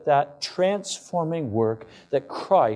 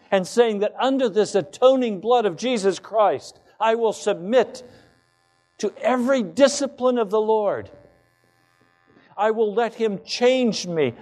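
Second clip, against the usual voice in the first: high, ringing preacher's declaration, building the vow line by line; fervent preaching; 7 semitones higher than usual. Acted. Pastor vows submission before the church, full-force preaching. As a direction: pastor urging the congregation to surrender, in full preaching voice.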